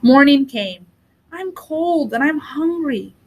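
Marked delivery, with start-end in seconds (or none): 1.3-3.1 put-on slow, high little-boy voice; a hungry, cold little boy, dragging the words; small child voice. Hansel tells his sister he is cold and hungry, plaintive.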